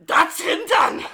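loud, high mock-German bark; over-the-top German exclamation; put-on German voice. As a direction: shout it in a hammed-up German accent. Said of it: "this voice when I talk German".

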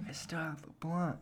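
barely voiced under the breath; whisper. Friend whispering to me that he kept the blunt, hushed.

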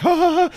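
sharp high yelp of shock; startled yelp. Retold or imitated. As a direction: yelp like you just got caught. Panicked yell at the counter.

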